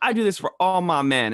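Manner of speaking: sweetly imitating a motherly Southern cashier; Southern lady voice. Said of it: "she's got a Southern accent".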